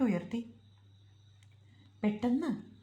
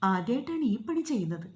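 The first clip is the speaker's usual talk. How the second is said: plain reading voice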